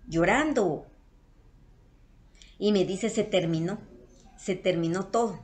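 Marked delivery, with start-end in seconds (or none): none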